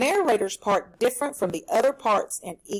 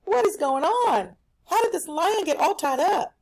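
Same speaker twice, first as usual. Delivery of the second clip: shocked mouse; 8 semitones higher than usual. Acted. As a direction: gasp it out in disbelief. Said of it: "he's like".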